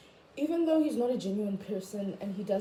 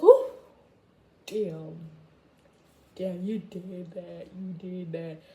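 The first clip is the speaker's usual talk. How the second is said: mock impressed; 3 semitones lower, 3 dB louder, slower than usual. Low mock-impressed remark.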